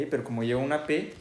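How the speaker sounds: ordinary delivery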